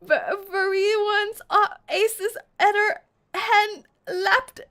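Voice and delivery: loud, high, halting mangled British; mock British voice. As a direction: struggle through overdone British, slowly and loudly. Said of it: "read this story in your British voice".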